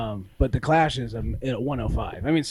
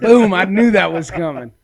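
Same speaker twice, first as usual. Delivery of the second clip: laughing; 6 semitones higher, 10 dB louder than usual. Real, not acted. Laughing louder, repeating the line.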